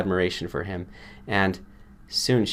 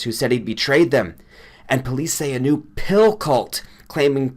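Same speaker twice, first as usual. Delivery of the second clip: higher, agitated male voice; a shaken friend reporting a killing; upset friend Granger; 5 semitones higher, 5 dB louder than usual. A narrator's reading.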